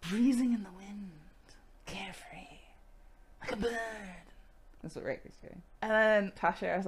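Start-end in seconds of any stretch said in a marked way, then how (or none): 0.1-3.8 wafting off into a whisper; mock-dreamy, with long pauses; airy carefree. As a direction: float the words away like a breeze. Voicing Riker drifting off, carefree.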